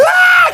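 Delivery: hammed-up family crying out over the dead dog; exaggerated cry of grief, retold; mock wail. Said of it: "everybody's like".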